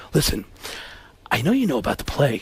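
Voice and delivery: low, soft, placating voice; Alec Baldwin. Acted. Baldwin broaching the play with Pacino, quiet and placating. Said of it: "And he goes".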